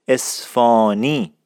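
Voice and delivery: "اصفهانی" as es-faaa-ni, h dropped; correct pronunciation model. Shown as a demonstration. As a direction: model "اصفهانی" for repetition with a stretched open aa. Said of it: "شما بگوین اصفهانی".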